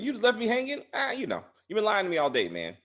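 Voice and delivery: mock-wounded, high; high whiny complaint. Voicing Tommy's hurt complaint.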